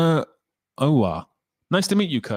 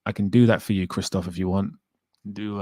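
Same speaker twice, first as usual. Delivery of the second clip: ordinary delivery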